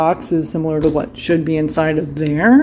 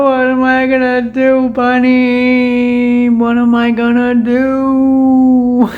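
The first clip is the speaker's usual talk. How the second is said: higher, louder, slowing pouty whine; mock whine; 4 semitones higher, 4 dB louder, much slower than usual. Asking Bunny again, a hammed-up whine. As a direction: overplay the despair. Whining to Bunny about cup.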